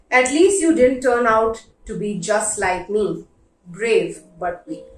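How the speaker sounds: ordinary delivery, nothing marked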